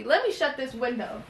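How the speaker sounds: usual speaking voice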